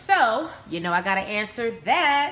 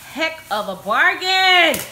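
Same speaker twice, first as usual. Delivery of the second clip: excited; 7 semitones higher, 9 dB louder than usual. Real, not acted. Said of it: "Sorry, I'm excited".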